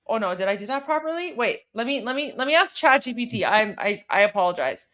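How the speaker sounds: ordinary talk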